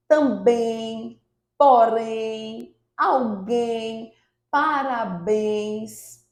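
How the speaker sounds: "também", "porém": slow, with a long final syllable; stress on last syllable. Shown as a demonstration. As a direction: dictate "alguém" and "parabéns" separately, stretching the endings. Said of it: "a última sílaba mais forte". ("também", "parabéns": última sílaba forte e bem alongada)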